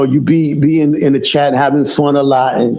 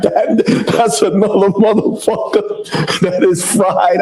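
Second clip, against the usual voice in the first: laughing; 4 semitones higher, slower than usual. Real, not acted. Laughing about another fried troll.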